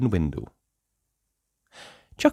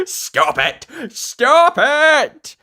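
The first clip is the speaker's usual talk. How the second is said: building to a high loud cry of stop it; laughing protest; 8 dB louder, much slower than usual. Acted. Tickled teacher begging the prankster, laughing loud protest. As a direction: beg between giggles, louder each time. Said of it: "laughing out loud and saying".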